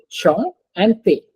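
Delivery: CHAN and TE set apart, slowly; split into syllables. Shown as a demonstration. Teacher confirms two syllables, split slowly apart. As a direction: sound out chan, then te. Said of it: "Yes, it's two".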